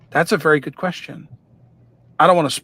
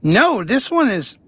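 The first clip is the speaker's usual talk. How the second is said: talking around a paintbrush in the mouth; muffled words with a brush held in the teeth; 7 semitones higher, 4 dB louder than usual. Real, not acted. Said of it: "talk with a brush in my mouth".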